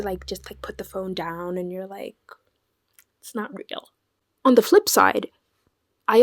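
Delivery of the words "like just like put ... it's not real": softly to herself. Retold or imitated. Calming self down.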